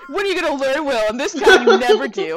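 laughing as she mocks the show's line; mock dramatic line. Friends mocking a TV line, loudly hammed up.

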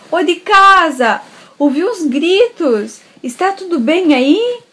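loud, slow, high hail; guard calling out. Acted. Guard arriving at grandma's house, calling loudly. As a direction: call into the house. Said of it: "o guarda chegou ... falou assim".

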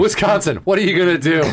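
hammed-up proud announcement; mock state slogan. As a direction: proclaim it like a silly state motto. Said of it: "Is that their slogan?".

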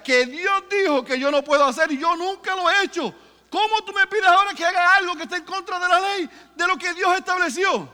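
high, loud pleading, the lines rushing; Peter's anguished protest. Acted. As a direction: cry out against the command. (Pedro suplica angustiado, alto y fuerte)

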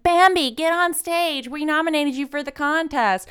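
high, eager call to the stage; pushy party guest. Retold or imitated. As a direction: urge her up like a hyped friend. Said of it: "they're like".

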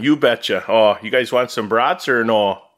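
put-on playful Green Bay accent; mock Wisconsinite offering food. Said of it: "Green Bay Wisconsinite accent".

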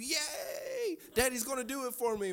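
put-on high-pitched "yay" of a child; imitated gleeful kid. Son rejoicing out of the bathroom, imitated gleeful kid. Son celebrating the secret deal.

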